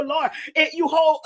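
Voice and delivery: usual speaking voice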